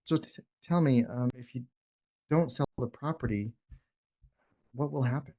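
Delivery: the right way, unhurried and soft; slow, calm ask. Shown as a demonstration. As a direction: ask gently and give it room. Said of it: "You guys see the difference?".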